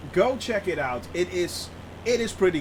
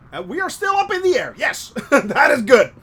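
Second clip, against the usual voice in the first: excited relief; 5 semitones higher, 7 dB louder than usual. Real, not acted. Relief still airborne.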